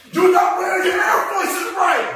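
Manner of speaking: high, loud mock-angry Brooklyn shout; mad Brooklynite. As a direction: yell like an outraged Brooklyn local. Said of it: "why you so mad at me".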